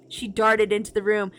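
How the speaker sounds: plain reading voice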